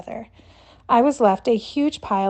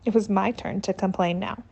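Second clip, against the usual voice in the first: usual speaking voice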